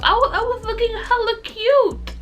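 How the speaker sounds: high, bright brag; excited. Real, not acted. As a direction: show off how cute you looked. Bragging about her date outfit.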